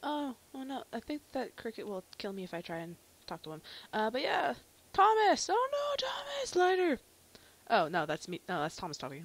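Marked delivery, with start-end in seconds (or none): 4.9-7.0 high, loud mock-panicked cries; alarmed calling out. Arthur spots Thomas and Lighter, high alarmed calls. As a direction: call the names out in hammy alarm.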